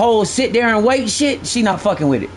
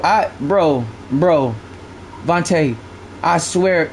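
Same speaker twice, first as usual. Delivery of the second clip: ordinary talk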